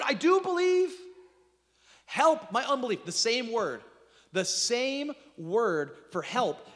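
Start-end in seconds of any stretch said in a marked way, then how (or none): 0.0-3.0 much higher and louder, crying out; high loud pleading exclamation; desperate cry. Acted. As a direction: cry out the father's desperate plea. Father's outcry retold at full force.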